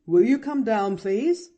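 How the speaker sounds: slightly grave. A narrator's reading. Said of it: "smiled the doctor a little gravely".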